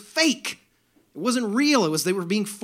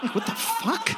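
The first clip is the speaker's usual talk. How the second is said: incredulous squeak. Real, not acted. Incredulous outburst at calm guy.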